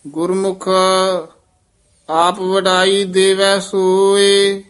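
measured, chanted scripture reading; scripture recitation with long pauses. Shown as a demonstration. Teacher chants Gurbani path.

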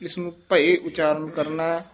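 normal talking voice

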